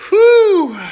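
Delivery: very high, loud exclaimed whew; relieved whew. Real, not acted. Movie finished, a loud high relieved whew. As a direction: blow it out in big relief. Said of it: "The movie over".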